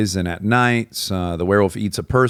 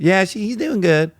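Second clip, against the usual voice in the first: put-on Southern old woman; 10 semitones higher than usual. Old woman talking to Richard's brother, Southern accent. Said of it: "calls the same woman".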